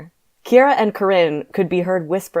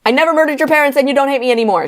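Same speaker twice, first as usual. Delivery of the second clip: high, loud rush of words; fast, run-together; 10 semitones higher, 7 dB louder, faster than usual. A narrator's reading. Corinne changing Kyron, fast and run-together shout. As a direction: blurt it out as if one word. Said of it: "talking and changing him so fast".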